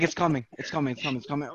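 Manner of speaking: whisper-like countdown of doom; fearful murmur. Retold or imitated. Man waiting for his panic attack, quietly fading dread.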